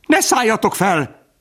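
high-pitched shout of warning; panicked pheasants calling out; alarmed cry. A narrator's reading. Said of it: "kiáltották a többiek".

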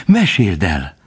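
eager, exclaimed plea; beside herself. A narrator's reading. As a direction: urge it out excitedly. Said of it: "kiáltott Faline magán kívül".

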